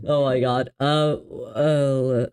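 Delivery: normal talking voice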